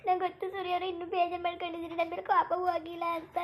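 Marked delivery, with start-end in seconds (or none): none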